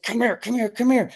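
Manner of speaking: high, soft urgent calling; urgent hushed call. Retold or imitated. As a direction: beckon them quickly, hushed.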